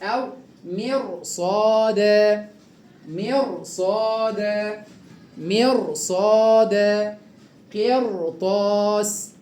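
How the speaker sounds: قرطاس and مرصاد said very slowly, ra thick; slow heavy ra. Shown as a demonstration. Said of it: "يبقى تفخم الراء هكذا".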